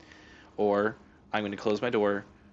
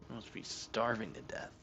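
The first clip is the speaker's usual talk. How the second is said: "You must be starving to death" murmured much softer, mock sad; soft mock pity; 12 dB quieter than usual. Real, not acted. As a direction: coo it quietly at the cat. Repeat to cat, much softer.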